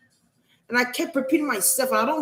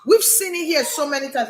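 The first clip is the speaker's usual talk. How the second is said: ordinary delivery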